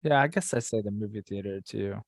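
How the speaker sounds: normal talking voice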